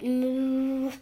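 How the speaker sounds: drawn-out, level cow moo; long imitated moo. A narrator's reading. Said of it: "And the cow just said".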